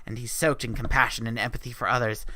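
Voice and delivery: normal talking voice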